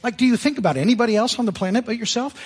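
mock indignation at a selfish driver; hammed-up disbelief; exasperated. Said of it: "I'll think".

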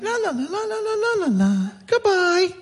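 high, sing-song toy voice chirping la la; talking toy voice. Retold or imitated. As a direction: sound like a little electronic toy. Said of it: "I hear this little talking".

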